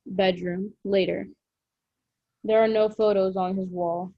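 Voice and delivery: usual speaking voice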